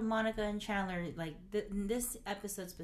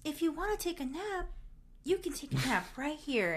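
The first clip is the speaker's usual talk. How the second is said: higher-pitched, lightly acted temptation; playfully persuasive, pitched up; coaxing; 9 semitones higher than usual. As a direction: lure them sweetly toward a nap. Chandler luring Monica to nap.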